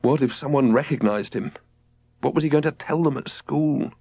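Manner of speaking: plain reading voice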